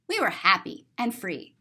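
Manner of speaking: ordinary delivery, nothing marked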